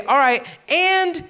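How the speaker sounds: a sly, smug collector squeezing extra money; greedy tax collector. Retold or imitated. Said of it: "He would say".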